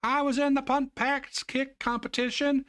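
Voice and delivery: high, slow proud storyteller voice; imagined grown girl. Pretend. The girl grown up telling her grandchildren, high proud voice. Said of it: "story to tell her grandchildren".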